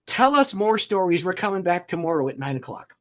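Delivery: loud, high, insistent plea; eager crowd. Retold or imitated. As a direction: call out like excited listeners.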